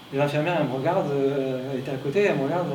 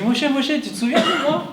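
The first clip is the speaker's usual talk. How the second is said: much higher and louder, smiling and laughing; cheerful call with a big grin; 10 semitones higher, 4 dB louder than usual. Retold or imitated. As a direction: greet him grinning and eager. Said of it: "Avec un grand sourire".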